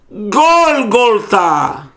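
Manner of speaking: Gólgota stretched slow with the O clear; slow Hebrew reading. Shown as a demonstration. Teacher shows the class the Hebrew word, slowly. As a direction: say Gólgota slowly, stressing the O sounds. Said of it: "Porque Gólgota não é grego".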